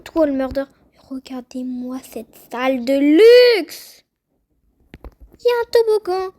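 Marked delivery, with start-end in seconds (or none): 1.1-4.0 drawn-out admiring exclamation, building loud; excited awe. Real, not acted. Showing off a luxurious map. 5.0-6.3 high, loud squeal of delight; excited discovery. Real, not acted.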